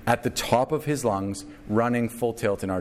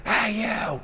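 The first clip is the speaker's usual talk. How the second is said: retold scream; 11 semitones higher, much slower than usual. Stranger hollering at the deck from afar, high mock yell. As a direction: yell it up high as if from blocks away. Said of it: "And it's just screaming".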